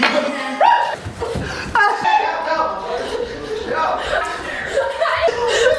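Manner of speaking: long high giggle; silly clown laugh. Acted. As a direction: giggle it out playfully. AI laughs after rotten egg.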